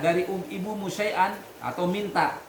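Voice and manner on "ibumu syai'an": ordinary delivery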